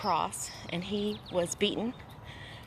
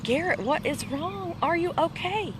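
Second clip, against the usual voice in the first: higher-pitched, concerned questioning; retold worried concern; 10 semitones higher than usual. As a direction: re-enact checking on an ailing husband. Retold worried question to ailing husband.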